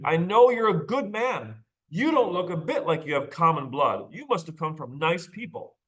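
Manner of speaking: put-on high, pleading old lady; frantic grandmother. A narrator's reading. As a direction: beg him to be a good man. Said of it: "the grandmother almost screamed".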